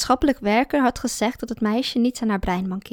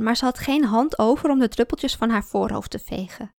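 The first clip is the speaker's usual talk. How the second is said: ordinary delivery, nothing marked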